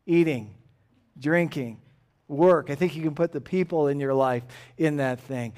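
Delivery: ordinary talk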